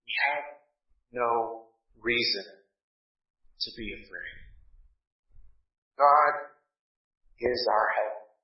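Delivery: quiet, intimate comfort to the congregation; hushed reassurance. Acted. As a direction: draw them in with a near whisper. Consoling climax of sermon.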